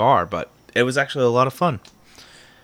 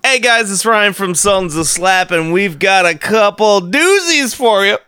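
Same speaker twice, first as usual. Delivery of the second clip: loud, higher hammy host intro; podcast host voice; 11 semitones higher, 6 dB louder than usual. Pretend. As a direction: overeager show host, louder and higher.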